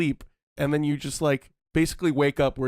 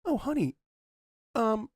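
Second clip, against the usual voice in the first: voicing a spouse; 6 semitones higher than usual. Imagined spouse quote, higher.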